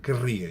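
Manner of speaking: querria with a long trilled rr; rolled double R. Shown as a demonstration. Teacher showing the conditional, "Querria" with trilled rr. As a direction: roll the R firmly in "Querria". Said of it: "uma R, e não uma ere".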